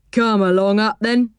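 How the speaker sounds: easygoing train driver character voice; put-on working man's voice. A narrator's reading. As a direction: invite the child aboard in character.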